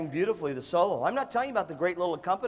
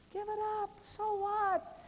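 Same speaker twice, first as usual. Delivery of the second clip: high squeaky falsetto; high-pitched, hammed-up dismissal; mock exasperation; 5 dB quieter, slower than usual. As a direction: brush it off in a high, silly voice. Acted dismissal of picky eaters.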